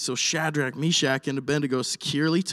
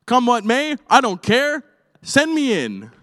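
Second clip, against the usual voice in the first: defiant cry; 7 semitones higher, 5 dB louder than usual. Acted. Voicing the brave men's defiance.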